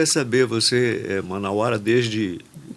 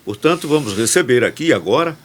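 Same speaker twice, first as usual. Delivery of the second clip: upbeat announcer read introducing the guest; podcast host intro; 3 dB louder than usual. Acted. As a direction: present the show and welcome the guest on air.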